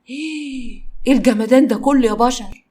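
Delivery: slow, drawn-out wonder; stunned awe at the sight; amazed. Acted. Malak gazes at the villa in slow amazement.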